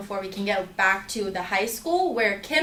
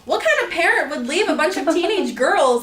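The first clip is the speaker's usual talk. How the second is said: higher and louder, outraged question; indignant inner rant; 5 semitones higher, 4 dB louder than usual. Retold or imitated. Ranting about Kim's mom, in higher, louder indignation. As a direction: rant at the absent parent. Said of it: "I'm like".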